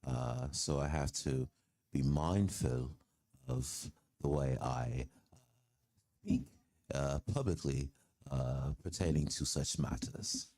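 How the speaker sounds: careful, hesitant put-on London-Aussie speech; British-Australian accent. Shown as a demonstration. Explaining the dialect in its own accent, mock-proper British-Australian. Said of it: "My British accent is kind of".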